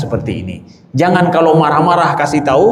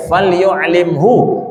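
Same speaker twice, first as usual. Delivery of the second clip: slow Arabic recitation; 2 semitones higher, much slower than usual. Retold or imitated. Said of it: "Atau riwayat lain".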